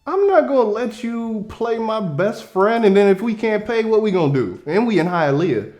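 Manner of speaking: defending my friend. Retold or imitated. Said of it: "I'm like".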